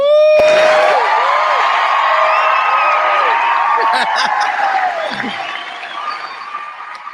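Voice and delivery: loud high whooping laughter. Real, not acted. Host whooping with delight on air. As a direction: cut loose with a big whoop.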